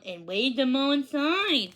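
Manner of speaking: plain reading voice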